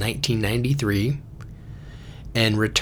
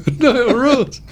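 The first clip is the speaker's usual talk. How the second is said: laughing yell; 5 dB louder than usual. Retold or imitated. Yelled laughing, driving off cliff.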